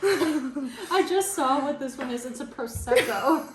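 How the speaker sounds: slow, laughing through the words. Real, not acted. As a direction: giggle while reading the label. Laughing at mismatched wine.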